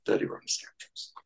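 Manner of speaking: breathy dramatic whisper of the title. Real, not acted. Revealing the next film to his co-hosts in a whisper. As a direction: hush it like a secret reveal.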